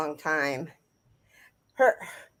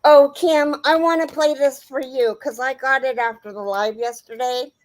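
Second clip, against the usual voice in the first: excited; 5 semitones higher, 6 dB louder than usual. Real, not acted. Excited call to viewer.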